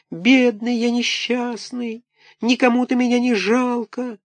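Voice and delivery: high, slow whimpering puppet voice; plaintive thin little voice. A narrator's reading. Buratino begs Karabas for pity, high whimper. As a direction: whine pitifully like a small wooden boy. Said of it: "подвывать жалобным тоненьким голоском".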